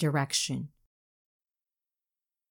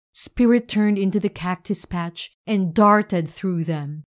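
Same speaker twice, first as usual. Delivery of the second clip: ordinary delivery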